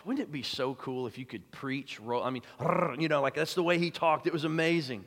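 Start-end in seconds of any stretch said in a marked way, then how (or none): 2.6-3.0 a long trilled rolled R. Shown as a demonstration. Preacher showing how Lloyd-Jones rolled his R's. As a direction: roll the R like the Welsh preacher. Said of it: "and he rolled his R's".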